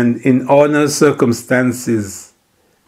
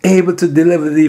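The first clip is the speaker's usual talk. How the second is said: preaching at full force; 6 semitones higher, 3 dB louder than usual. Acted. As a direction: lift the verse up to the rafters. Preaching builds.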